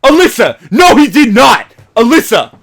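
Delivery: high, loud shouting in disbelief; hyped shout. Retold or imitated. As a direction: yell at your girlfriend in excitement. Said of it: "I'd say".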